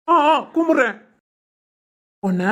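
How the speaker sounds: high pained groans; pained surrender. Acted. Bully gives in to the hero in pain. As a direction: give in while it hurts.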